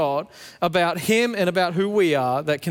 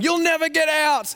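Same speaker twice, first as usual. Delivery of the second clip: high teasing threat; mock villain taunt; 10 semitones higher than usual. Dad taunting trapped son from across the kitchen, mock villain. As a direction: tease like a pantomime baddie.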